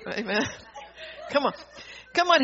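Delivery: usual speaking voice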